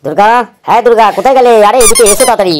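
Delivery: loud, high, urgent call; shouting for someone. Acted. Husband summoning his wife Durga to come quickly, shouting. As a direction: yell to summon someone from another room. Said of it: "এত চেঁচাচ্ছ কেন তুমি".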